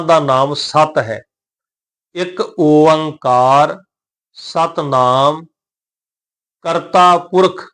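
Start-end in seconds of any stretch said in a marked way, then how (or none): 2.1-5.5 ਇੱਕ ਓੰਕਾਰ ਸਤਨਾਮ very slow, long vowels held; careful pronunciation. Shown as a demonstration. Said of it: "ਇਹਦਾ ਸਹੀ ਉਚਾਰਨ ਹੋਏਗਾ". 6.6-7.6 ਕਰਤਾ ਪੁਰਖ slow, in one phrase; joined reading. Shown as a demonstration. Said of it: "ਇਹ ਦੋਨੋਂ ਸ਼ਬਦ ਇਕੱਠੇ ਪੜਾਂਗੇ".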